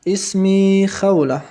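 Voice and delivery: اسمی خوله said slowly and clearly, stress on اسمی; slow Arabic model. Shown as a demonstration. Teacher models the phrase for students to repeat, slow and clear. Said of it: "و اعد، تکرار کن".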